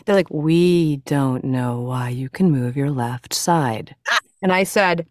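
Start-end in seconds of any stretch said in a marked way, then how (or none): 0.3-3.9 lower, drawn-out imitation of careful doctors; very slow, gentle. Doctors puzzling over her left side, slow and gentle. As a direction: mimic a doctor spelling it out slowly. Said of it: "doing that same very slow".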